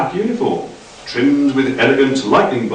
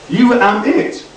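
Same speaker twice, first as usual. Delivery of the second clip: much higher, fussy correction; squeaky Doc; 10 semitones higher than usual. A narrator's reading. Doc correcting Frank's grammar, high fussy voice. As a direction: pipe up high and prissy.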